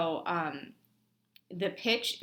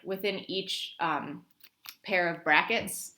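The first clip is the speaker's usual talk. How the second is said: usual speaking voice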